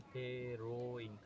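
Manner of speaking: फेरोइंग stretched out very slowly, each sound held; drawn-out dictation. Shown as a demonstration. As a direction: spell out फेरोइंग slowly for notes. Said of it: "प्रसव को क्या बोलते हैं?". (फेरोइंग को बहुत धीरे खींचकर बोलें)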